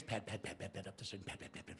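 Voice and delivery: pad sounds fading to a breath; fading footsteps. Acted. Storyteller fades Molly's footsteps going upstairs. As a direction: let the steps fade away upstairs.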